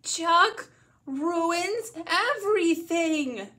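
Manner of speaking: high, loud, drawn-out outburst; yelling. A narrator's reading. Child finally snapping about little brother, full yell. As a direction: explode slowly, leaning on each word. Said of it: "I yell".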